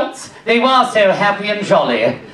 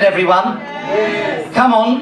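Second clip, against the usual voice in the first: usual speaking voice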